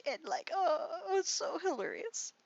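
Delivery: high, laughing through the words. Real, not acted. Recalling the witch's death, laughing high through the words. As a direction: giggle it out.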